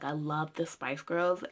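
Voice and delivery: ordinary talk, nothing marked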